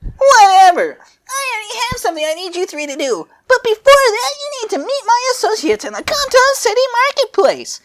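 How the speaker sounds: very high squeaky goddess voice; way up high, bossy and quick; Cronoa voice. Acted.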